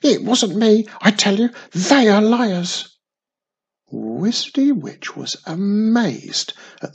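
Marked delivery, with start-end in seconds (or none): 0.0-2.9 high, loud, indignant denial; loud protest. A narrator's reading. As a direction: insist you did nothing. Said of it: "protested his innocence again".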